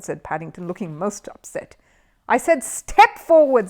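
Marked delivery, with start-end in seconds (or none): 2.3-3.7 loud, slow, cross correction. A narrator's reading. Host corrects Paddington, cross and loud. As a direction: snap it out, slow and loud, at the bear. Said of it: "said Ronnie Playfair crossly".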